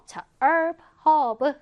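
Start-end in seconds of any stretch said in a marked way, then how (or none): 0.4-0.8 "herb" said as "erb", no H; "herb" starting straight on the vowel; silent-H herb. Shown as a demonstration. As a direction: drop the H in "herb", American style. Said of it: "H 발음 하지 않아요".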